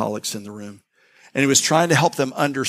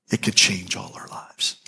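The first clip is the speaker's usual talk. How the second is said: soft whisper; 6 semitones lower, 4 dB quieter than usual. Real, not acted. Intimate invitation to congregation.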